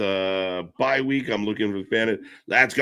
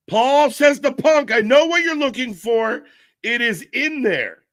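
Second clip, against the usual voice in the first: high, loud excited announcing; breathless news shouted to the viewers; excited relay; 9 semitones higher, 5 dB louder than usual. Real, not acted. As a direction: call it out in high-pitched excitement. Relaying live show moment excitedly.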